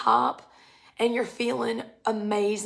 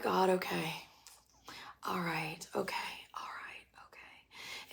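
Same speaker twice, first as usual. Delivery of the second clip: softer and softer until whispered; fading to a whisper; 3 semitones lower, 12 dB quieter than usual. Retold or imitated. Seeker giving in to God, fading to a whisper. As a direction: trail off into a whisper of surrender. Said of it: "You're like".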